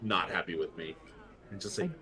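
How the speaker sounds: usual speaking voice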